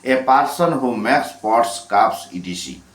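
English prompt read very slowly, word by word; slow reading of the question. Shown as a demonstration. Teacher dictating the question, very slowly. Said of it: "আট নম্বরে".

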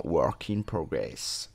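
low, slow English accent; mock English accent. Shown as a demonstration. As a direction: show off an English accent, drawn out. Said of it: "il est beau mon accent anglais".

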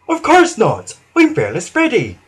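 high, loud opening then proud; boastful denial of fear; chuffed bravado. A narrator's reading. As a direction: protest indignantly, pitched well up. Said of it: "chuffed Freddy".

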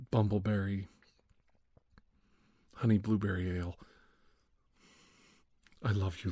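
ordinary delivery

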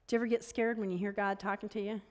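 ordinary delivery

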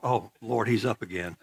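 put-on God rolling his eyes at a troublesome child; weary God. God sighing about the preacher, low and weary.

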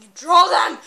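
loud exasperated yell; frustrated shout. Real, not acted. Spectating player yelling his frustration. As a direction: shout the wish out.

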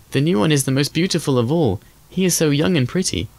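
put-on high-pitched admiring child's voice; delighted children. A narrator's reading. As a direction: gush in a high voice like happy little children. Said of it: "shouting joyously".

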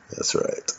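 breathy unvoiced whisper of that's right; a hushed aside to yourself. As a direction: say it under your breath.

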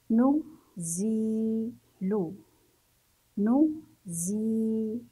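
نزيل split into nu, zii, lu; نزيل in separate parts, pausing between them; syllable by syllable. Shown as a demonstration. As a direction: sound out نزيل very slowly with long zii. Teacher breaks word into syllables.

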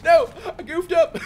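high, very loud yell; real outburst at a blunder; dismayed shout. Real, not acted. Gamer to viewers after botching the jump, dismayed shout.

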